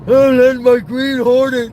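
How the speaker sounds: high, loud, stretched-out wail; comic outburst. Acted. Grouch in a film impression, high loud outburst. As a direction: wail it with held vowels.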